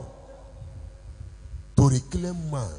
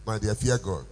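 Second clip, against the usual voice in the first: ordinary talk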